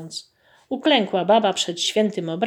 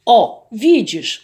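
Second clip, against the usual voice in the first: pleased peasant; 3 semitones higher, 6 dB louder, slower than usual. Acted. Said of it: "ucieszył się Mateusz".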